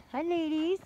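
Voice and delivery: high, sing-song hello; sweet greeting. Real, not acted. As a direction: greet the little ones brightly. Greeting her little girls.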